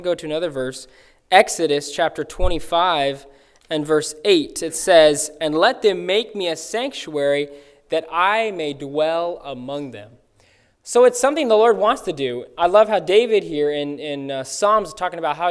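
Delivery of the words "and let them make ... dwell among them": ordinary delivery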